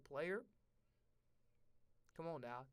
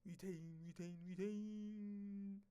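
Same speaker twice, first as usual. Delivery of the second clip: mock drawl; 8 dB quieter, much slower than usual. Mocking the word retained.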